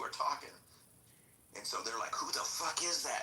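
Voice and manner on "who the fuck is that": retold scream. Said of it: "they're screaming at him".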